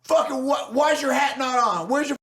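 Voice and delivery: hammed-up soldier scolding a general; mock yell at general. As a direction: yell at the general like a drill sergeant. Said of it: "I'd be like".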